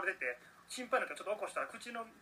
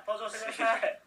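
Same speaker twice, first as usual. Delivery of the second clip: raised, louder summoning call; mock guest introduction; 5 semitones higher, 5 dB louder than usual. Co-host jokingly summoning the recommended products, mock announcer call. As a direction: pretend to call a guest onstage.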